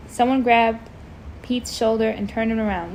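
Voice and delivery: ordinary delivery, nothing marked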